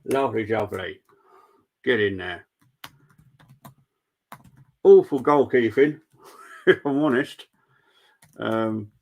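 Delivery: talking round a pen in the mouth; words blurred by a pen between the teeth. Real, not acted. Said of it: "I got a pen in my mouth".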